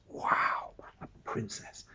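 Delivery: hushed, breathy wonder at the cover; awed whisper. Real, not acted. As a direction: whisper it like a secret discovery. Teacher marvels at book cover.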